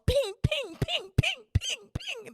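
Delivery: very high, staccato pings; ping like something ricocheting off walls; imitated bouncing noise.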